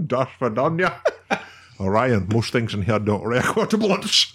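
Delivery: low put-on Russian accent; mock Russian accent. Joking farewell after the Russian bit, mock Russian accent. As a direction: do a hammed-up Russian film impression.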